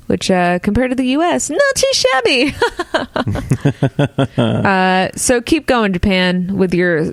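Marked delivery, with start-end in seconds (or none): 1.5-2.5 wry, much higher dry irony; sarcastic understatement. Real, not acted. Host jokes about U S virus numbers, wry sarcasm. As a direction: undersell it with a smirk. Joking comparison with the U S.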